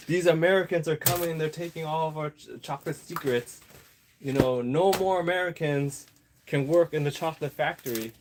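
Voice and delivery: high, alarmed put-on voice; a playful parody of a worried factory owner; mock German complaint. Germany voiced complaining about Americans.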